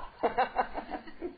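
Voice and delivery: short laugh at own joke; brief amused laughter. Real, not acted. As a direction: chuckle after a silly impression. Laughs after his accent joke.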